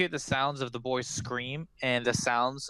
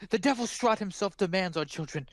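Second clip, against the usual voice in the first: desperate pleading; 8 semitones higher than usual. Acted. Frantic mother voiced by GM.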